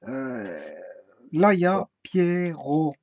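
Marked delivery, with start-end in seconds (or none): 2.0-2.9 "Pierrot" slow, as pier-ro; two-syllable breakdown. Shown as a demonstration. Teacher counting two syllables, "Pierrot" pulled apart. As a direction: split "Pierrot" into two held beats. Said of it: "Il y a deux syllabes".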